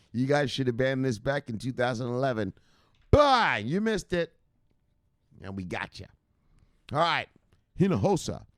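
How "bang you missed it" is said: excited shout. Real, not acted.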